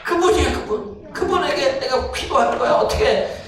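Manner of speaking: high, loud, surging sermon voice; fiery preaching. Acted. Pastor building the congregation to fervor, fiery preaching. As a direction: preach it with everything, rising.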